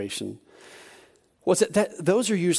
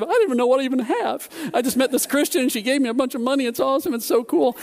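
high-pitched giddy gush, retold; beside himself; 9 semitones higher than usual. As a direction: babble it high, overwhelmed with excitement. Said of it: "I was beside myself".

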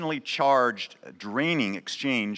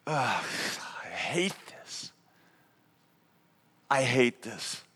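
soft, slow, troubled grumble; a quiet inner groan of disgust, drawn out; agitated groan; 4 dB quieter, slower than usual. Acted. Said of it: "It will sound something like this".

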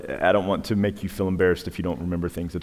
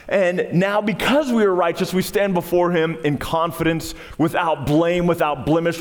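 raised, pitched-up pulpit preaching; full-force preaching; 8 semitones higher than usual. Acted. Pastor exhorting the congregation, full-force preaching. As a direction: drive the point home to the congregation, higher.

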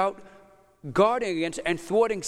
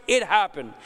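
ordinary talk

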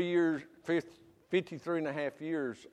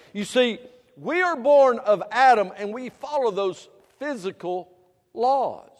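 raised, loud sermon voice; preaching; 5 semitones higher, 7 dB louder than usual. Acted. Preacher exhorting the congregation, loud and fervent. Preacher rising to full force.

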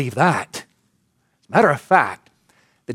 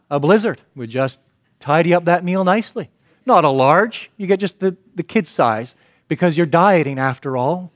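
ordinary delivery

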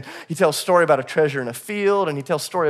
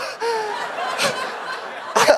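high laugh. Real, not acted. Laughing at the memory.

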